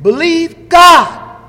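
high, loud, very slow command; booming exhortation. Real, not acted. Preacher urging the saints to believe God, booming. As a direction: drive it home to the congregation.

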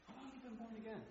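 near-whispered friend's question; quiet like Nicodemus. Retold or imitated. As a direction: ask it quietly, like a secret. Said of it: "He walked over and said".

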